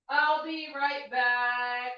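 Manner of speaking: clingy mock plea, drawn out; begging an ex back. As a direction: plead theatrically while walking away. Pleading as she leaves.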